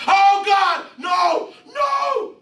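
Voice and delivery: high, loud cry of horror; a father screaming as he sees the truth; anguished scream. Acted. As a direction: howl it out in despair. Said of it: "he screamed".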